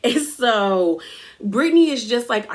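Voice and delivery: ordinary delivery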